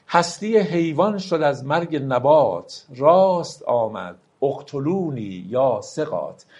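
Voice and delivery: slow, solemn verse reading; slow poem recital. Acted. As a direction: let each line of the poem ring out slowly. Recites Rumi verse slowly. (دکلمهٔ آرام و باوقار شعر مولانا)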